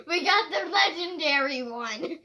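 high, loud thrilled cry; excited cry. Real, not acted. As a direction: cry it out with joy. Finds the rare doll.

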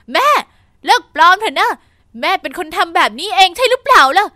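loud angry shout at her mother. Acted. Daughter shouts at her lying mother, angry and loud. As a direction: yell out the accusation. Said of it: "ก็เลยตะโกนขึ้น".

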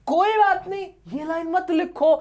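high-pitched, playful concession; mock indulgence. Teacher lets fussy students skip the line, mock indulgence.

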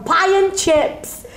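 put-on high, drawn-out broad northern accent. Telling how broad she became at Disney, exaggerated northern accent. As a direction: go hugely broad northern, stretching each word. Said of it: "I became so broad".

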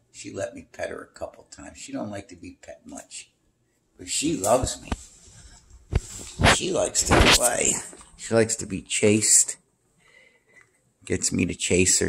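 ordinary talk, nothing marked

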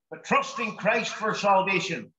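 ordinary talk

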